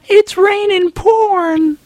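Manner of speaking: high, slow joyful shout to the sky; ecstatic cry. Pretend. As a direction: cry out in rapture, drawn out. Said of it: "people reaching up to the sky going".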